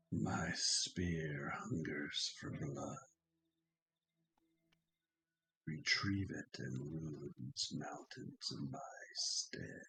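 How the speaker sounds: slow ghostly whisper on the wind; talking tree. Acted. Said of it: "a whisper in a deep voice, as if it's carried upon the wind".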